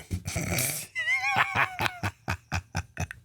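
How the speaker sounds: big high burst of laughter, almost shouting. Real, not acted. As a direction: crack up at your own joke. Laughing at own impression.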